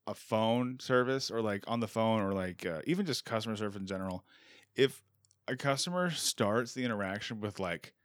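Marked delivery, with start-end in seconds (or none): none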